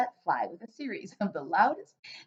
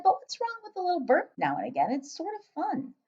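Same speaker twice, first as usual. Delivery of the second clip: put-on higher, curious young girl; little girl voice; 9 semitones higher than usual. Sophie asking the giant, light girl voice. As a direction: ask like a puzzled small girl.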